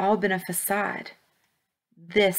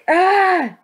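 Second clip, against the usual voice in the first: loud, high yell of effort; straining cry; 12 semitones higher, 8 dB louder than usual. A narrator's reading. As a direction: cry out as you heave with all your might. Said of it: "he cried, his face straining".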